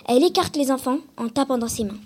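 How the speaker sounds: usual speaking voice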